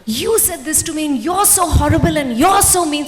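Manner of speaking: hammed-up accusing shout; mock shout of you're so horrible; mock angry outburst. Shown as a demonstration. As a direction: blurt it out like a hurt partner. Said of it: "we say it the wrong way".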